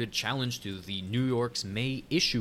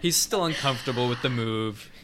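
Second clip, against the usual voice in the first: laughing; 4 semitones higher, 6 dB louder than usual. Real, not acted. Joking while laughing.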